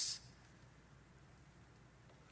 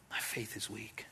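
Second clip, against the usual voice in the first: hushed, ashamed whisper; whispered confession; 6 semitones lower, 10 dB quieter than usual. Retold or imitated. A hurting churchgoer whispering doubts to the pastor. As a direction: breathe it out like a secret.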